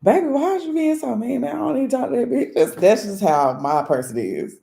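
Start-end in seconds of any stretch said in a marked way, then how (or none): 0.0-2.5 high-pitched, whiny protest; hammed-up wounded complaint; mock pleading. Acts out pleading with a partner.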